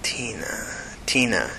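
hushed, half-whispered TINA, falling away; mumbling to himself. A narrator's reading. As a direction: turn the name over under your breath. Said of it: "he mumbled to himself".

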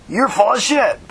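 high-pitched, angry accusation. A narrator's reading. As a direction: throw it back angrily. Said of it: "Bailey said angrily".

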